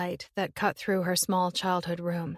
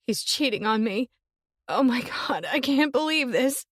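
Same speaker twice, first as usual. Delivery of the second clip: higher, louder, close to tears; distraught sister; 5 semitones higher, 4 dB louder than usual. Acted. Summer breaking down on the phone, distraught. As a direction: panic rising in her throat.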